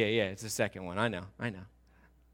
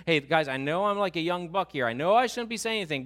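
higher, louder, hurried excuse; young buck speaking up; 5 semitones higher, 6 dB louder than usual. Acted. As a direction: pipe up eagerly as the junior one. Voices young Elihu interrupting elders.

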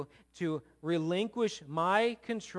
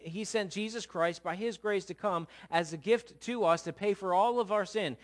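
usual speaking voice

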